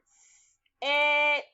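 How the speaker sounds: the sounds "s, et" said apart; sounds said one by one. Shown as a demonstration. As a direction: pull "s" and "et" apart clearly. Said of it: "The sounds in the second syllable are".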